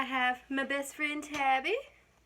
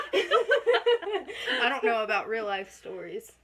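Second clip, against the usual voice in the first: slow, laughing through the words; 5 dB louder, much slower than usual. Real, not acted. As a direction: tease her while cracking up. Teasing friend while laughing.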